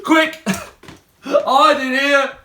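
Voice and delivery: Ugly Mug calls the others to hide; urgent cry. Acted. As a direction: hurry the others into the bin. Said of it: "cried Ugly Mug".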